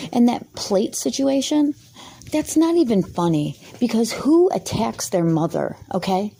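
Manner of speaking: ordinary talk, nothing marked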